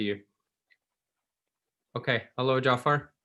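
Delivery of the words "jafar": ordinary delivery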